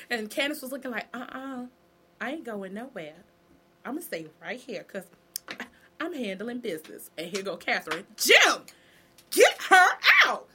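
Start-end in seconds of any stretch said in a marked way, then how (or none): 1.1-7.1 quiet stubborn refusal. Retold or imitated. Candace refusing to leave the family, quietly defiant. 8.1-10.5 loud and high, get her out; shouted order. Retold or imitated. Catherine yelling at Jim to remove Candace, loud.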